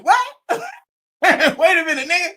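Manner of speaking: laughing, high-pitched disbelief; baffled outburst breaking into a laugh; incredulous. Real, not acted. Reacting in disbelief to clip.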